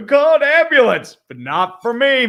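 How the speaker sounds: loud, high mock cry for help; acted meme quote. Co-host quoting a meme from a chat message, loud and high. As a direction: call out in mock alarm, then stretch the turn.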